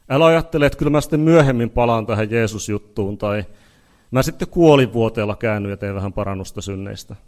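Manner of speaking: loud, urgent sermon warning; full-force revival preaching; forceful preaching. Acted. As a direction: preach it hard to the congregation. Preacher warns against delay.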